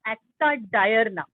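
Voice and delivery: "acta diurna" said as ak-ta dye-ur-na; "acta diurna" with a long i in "diurna"; correct pronunciation. Shown as a demonstration. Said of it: "pronounce it as".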